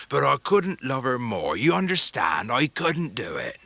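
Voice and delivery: slow rough fisherman voice; a homely old sailor, deeply moved; Mr Peggotty. A narrator's reading. Mr Peggotty welcoming guests by fire, rough sailor voice.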